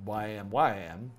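normal talking voice

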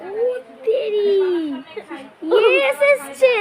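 high, loud startled cry; startled shout. Real, not acted. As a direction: burst out in shock. Coin shows yes, startled.

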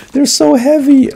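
high, loud, straining cry; a high, loud complaint about the weight; strained exclaim. Real, not acted. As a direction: groan out how heavy it is, high. Overloaded with fish.

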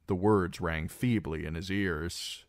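ordinary delivery, nothing marked

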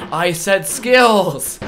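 slow, loud, laughing exasperation on skills; exasperated loud. Real, not acted.

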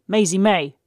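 a high, loud call across the yard; shout. Acted. Searching for Maisie May, calling her name in a loud shout. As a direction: bellow her name from the hall door. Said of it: "someone shouted".

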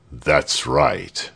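low, smooth tyrant; satisfied governor. A narrator's reading. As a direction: approve coolly, pitched low.

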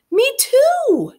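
very high and loud squeal; eager call-out. A narrator's reading. Girl joining in eagerly, high loud call-out. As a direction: shout it out like a keen kid.

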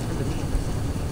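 breathy whisper of dread. Real, not acted. Gamer whispering in a dark level, scared and hushed. Same words, now whispered.

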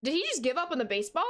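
ordinary delivery

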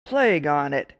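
loud annoyed outburst; exclaiming. Acted. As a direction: curse it out loud. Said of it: "exclaimed the soldier".

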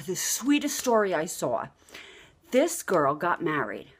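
normal talking voice

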